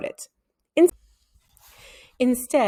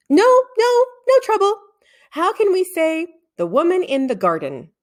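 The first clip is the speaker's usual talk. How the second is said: put-on higher, hurried boyish denial; flustered boy; 10 semitones higher, 5 dB louder than usual. A narrator's reading. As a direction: brush off the question nervously. Tom hastily denies trouble.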